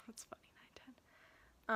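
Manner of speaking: laughing softly under breath; amused whisper to yourself. Real, not acted.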